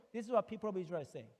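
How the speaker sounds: normal talking voice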